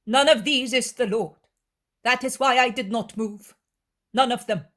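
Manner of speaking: firm denial. Acted. Young woman rejecting the fake Lord, firm and loud. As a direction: flatly refuse each one.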